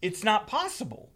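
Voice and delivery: high-pitched, indignant retold question; exasperated customer, reenacted; getting mad. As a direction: demand an answer in disbelief. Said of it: "now I'm getting mad".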